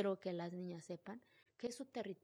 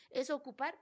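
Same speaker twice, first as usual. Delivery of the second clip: usual speaking voice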